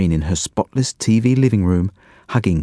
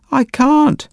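put-on high, louder little boy yell; child's yell; 4 dB louder than usual. A narrator's reading. Leo yelling from the locked toilet, high panicky yell. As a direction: shout it in a panic from behind the door. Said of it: "yelled Leo".

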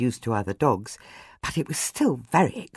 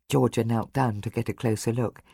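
ordinary delivery, nothing marked

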